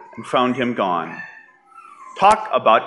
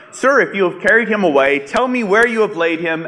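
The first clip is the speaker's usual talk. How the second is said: politely pleading; 6 semitones higher, faster than usual. Acted. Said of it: "a little more politely".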